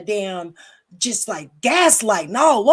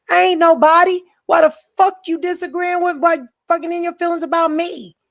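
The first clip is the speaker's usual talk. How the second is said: exasperated outburst; 6 semitones higher, faster than usual. Real, not acted.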